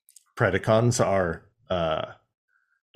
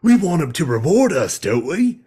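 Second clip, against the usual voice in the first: Zaid-like growl; 5 semitones higher than usual. Retold or imitated. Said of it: "sounded like Zaid from Mass Effect".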